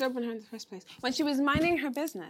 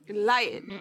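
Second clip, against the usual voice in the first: ordinary talk, nothing marked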